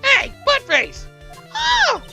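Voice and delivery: high, slow squeaky dummy heckle; loud, high puppet taunt; squeaky ventriloquist dummy. Acted.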